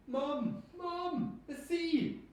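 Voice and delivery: loud, high panicked calls to mum; alarmed calling. Acted. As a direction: yell for mum as the sea rushes in. Boy calls mother about flood.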